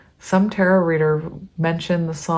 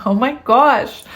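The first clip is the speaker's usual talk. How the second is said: high, loud burst of amazement; excited exclamation; 6 semitones higher, 4 dB louder than usual. Real, not acted. Reader overwhelmed by the lyrics, exclaiming loudly. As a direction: blurt it out, overwhelmed and thrilled.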